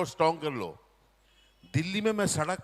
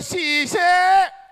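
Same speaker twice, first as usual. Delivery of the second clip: high, slow drawn-out call of the name शीशे; the loud Punjabi aunty stretching out "शीशे"; 10 semitones higher, 8 dB louder, much slower than usual. Retold or imitated. Punjabi aunty calls the boy, high and drawn out. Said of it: "aunty ने उधर जाते बोले".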